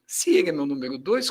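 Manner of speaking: ordinary talk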